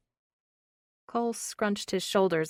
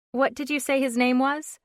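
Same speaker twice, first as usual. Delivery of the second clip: put-on young woman voice; 7 semitones higher, 5 dB louder than usual. A narrator's reading. Brooke asks.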